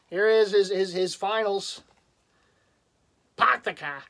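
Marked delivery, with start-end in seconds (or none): 3.4-4.0 mock Boston accent, R's dropped. Teasing Celtics fans with a stock phrase, Boston accent. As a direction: drop the R's like a caricature Bostonian. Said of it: "try to say it with a Boston accent".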